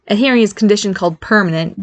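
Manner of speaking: ordinary delivery, nothing marked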